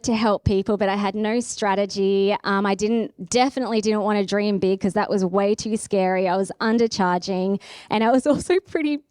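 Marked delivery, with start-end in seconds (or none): none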